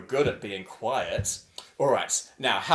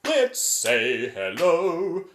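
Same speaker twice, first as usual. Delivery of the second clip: very slow, drawn-out chant; super slow; 7 dB louder, much slower than usual. Shown as a demonstration. Showing the class super duper slow chanting. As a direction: stretch every word out slowly. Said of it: "super duper slow".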